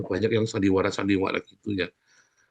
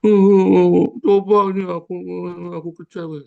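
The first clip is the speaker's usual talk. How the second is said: mock crying; 7 semitones higher, 7 dB louder than usual. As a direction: imitate someone bawling in hammed-up disappointment. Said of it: "Sampai bom-bom nangis-nangis". (pura-pura menangis meraung)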